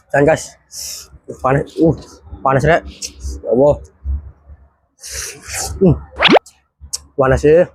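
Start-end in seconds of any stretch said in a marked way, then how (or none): 1.3-7.7 mouth full of hot food. Real, not acted. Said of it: "Bubur nget-ngetan rada panas sithik".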